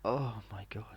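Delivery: breathy stunned whisper; shocked whisper. Retold or imitated. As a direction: whisper it in disbelief. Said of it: "I'm like".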